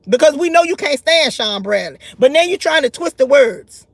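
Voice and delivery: ordinary talk, nothing marked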